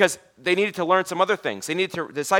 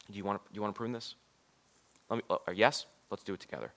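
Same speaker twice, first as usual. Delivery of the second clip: gently; 5 semitones lower than usual. Acted. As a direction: coax gently, like a patient gardener. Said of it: "he's gonna do it so gently".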